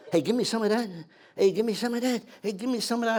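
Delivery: high eager pleading voices; begging soldiers. Retold or imitated. Wounded soldiers begging for beer.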